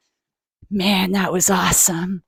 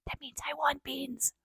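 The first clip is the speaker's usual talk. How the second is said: whisper; 5 semitones higher, 19 dB quieter than usual. Real, not acted. Whispered translation aside.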